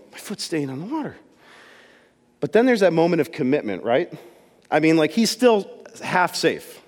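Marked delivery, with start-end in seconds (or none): 0.1-1.2 much softer, amazed murmur; awed hush. Retold or imitated. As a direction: say it softly in disbelief. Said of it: "And Peter's like".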